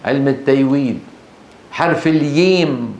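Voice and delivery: التيويد and الييم with y for j; jim as ya. Retold or imitated. Teacher playfully repeats the regional sound, jim as ya. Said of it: "يقلبون الجيم إيه".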